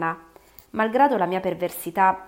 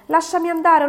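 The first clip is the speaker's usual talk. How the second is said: put-on child's voice, much higher and far louder; a boy begging to be let go at the top of his lungs; desperate child's scream; 10 semitones higher, 9 dB louder than usual. (bambino disperato che urla, voce molto acuta e fortissima)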